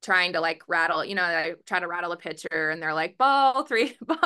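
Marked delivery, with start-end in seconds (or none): 3.2-4.2 high, loud imitation of a taunt; mock heckling. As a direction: call it out like rival players rattling a pitcher. Imitating rival teams rattling the pitcher.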